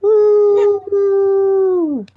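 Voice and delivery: loud high cheer; cheering woo. Real, not acted. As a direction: whoop with joy. New Year cheer.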